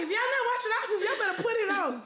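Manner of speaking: high, laughing hype; excited urging. Retold or imitated. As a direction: urge everyone to tune in. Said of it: "I was like".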